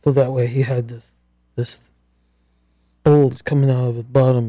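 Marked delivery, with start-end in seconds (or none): none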